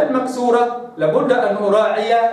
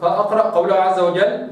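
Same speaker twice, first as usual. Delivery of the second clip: ordinary talk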